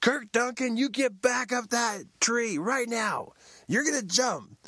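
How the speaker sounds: high insistent call; calling out orders. Retold or imitated. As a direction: call him back from down the road. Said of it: "come running up the road".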